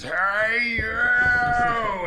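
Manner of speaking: high, loud shout of hey you; angry yell. Retold or imitated. Stranger yelling from the garbage pile, loud angry shout. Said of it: "and he just says".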